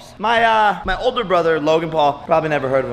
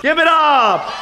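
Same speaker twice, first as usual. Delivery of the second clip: shouting; 10 semitones higher, slower than usual. Real, not acted. Calling for applause.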